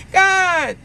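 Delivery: loud, high plea to God; desperate call. Retold or imitated. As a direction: call out to God in mock despair. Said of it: "that was calling".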